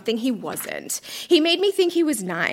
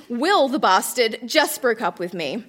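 ordinary delivery

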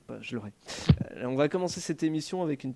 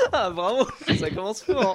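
ordinary talk, nothing marked

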